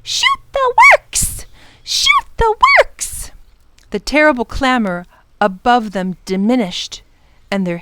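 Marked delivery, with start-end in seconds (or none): 0.0-3.3 very high, loud, slow screech; ferocious bird shriek. A narrator's reading. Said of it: "shrieking ferociously".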